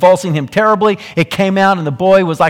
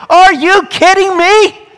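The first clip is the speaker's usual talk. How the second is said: incredulous; 7 dB louder, slower than usual. Acted. Jesus echoes the doubt incredulously.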